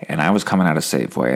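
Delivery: ordinary delivery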